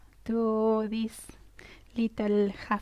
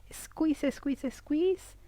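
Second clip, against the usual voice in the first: high-pitched, urgent squeeze cue; tense, high coaching chant; straining squeeze cue; 5 semitones higher, much slower than usual. Shown as a demonstration. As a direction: push the voice up high as if tensing. Coaching tense-and-release exercise.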